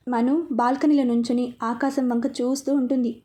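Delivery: ordinary delivery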